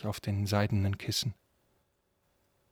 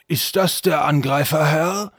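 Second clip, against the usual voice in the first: loud, high, rising question; castle guard; 6 semitones higher, 7 dB louder than usual. Acted. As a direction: call out the question to your master. Guard questions his master. (laut fragender Wachmann)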